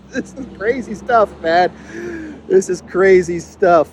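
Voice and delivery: high voice still full of laughter; laughing disbelief. Real, not acted. Host reacting to crazy skating news, laughing in disbelief. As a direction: crack up in amazement.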